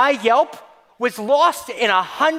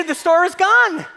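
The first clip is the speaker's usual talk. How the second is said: higher, gloating announcement; gleeful; 6 semitones higher than usual. Shown as a demonstration. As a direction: crow it out in triumph.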